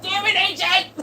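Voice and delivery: a squeaky cartoon shout; mock outrage.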